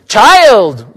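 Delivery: put-on loud, high shout at the girl; a loud, commanding shout. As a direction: stop her in her tracks with a shout. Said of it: "Willa shouted".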